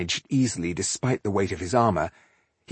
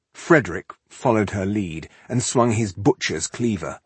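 ordinary delivery

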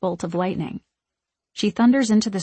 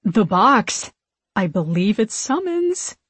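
hesitant, rising suggestion; cautious sister speaking up; tentative venturing; 2 semitones higher than usual. A narrator's reading. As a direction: offer it timidly, trailing upward. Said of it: "Mary ventures".

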